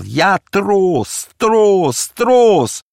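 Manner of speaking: loud, very high raging shout; wild, anguished fury; furious. A narrator's reading. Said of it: "яростно продолжал".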